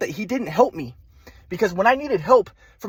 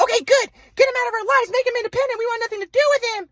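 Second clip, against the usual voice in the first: much higher mocking parents voice. Son mocking his parents cutting him off, much higher voice. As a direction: parody the parents' brush-off, pitched way up. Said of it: "my mom and dad are like".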